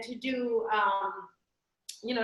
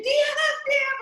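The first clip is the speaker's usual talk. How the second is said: high-pitched playful scream for help; mock scream; 13 semitones higher, 4 dB louder than usual. Wife calling husband after jumping off, high mock scream. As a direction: scream the name in comic panic. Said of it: "and we scream".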